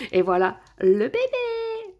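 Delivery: high, drawn-out singsong ta-da; stretched, high-pitched squeal of pride; gleeful reveal. Real, not acted. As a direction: present it like a precious newborn. Proudly revealing the CD to viewers.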